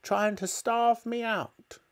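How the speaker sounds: high-pitched indignant grandma tirade; grandma shouting. A narrator's reading. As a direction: protest at a high pitch, stretching the last words. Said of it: "shouted Grandma".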